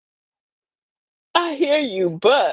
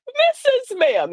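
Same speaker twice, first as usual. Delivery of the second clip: put-on drawn-out, high "Missus Ma'am" in a child's sing-song; mock child voice; 13 semitones higher, much slower than usual. Grandma imitating her four-year-old granddaughter, slow and high. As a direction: say "Missus Ma'am" slowly and high like a little girl.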